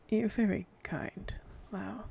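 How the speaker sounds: whispered gently; soft whisper. Acted. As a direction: soothe him in a hushed whisper. Chifley soothes Lau.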